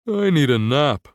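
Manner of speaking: moaning through a yawn; sleepy groan mid-yawn. A narrator's reading. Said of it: "Gus moans through a yawn".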